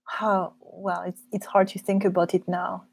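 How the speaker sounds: usual speaking voice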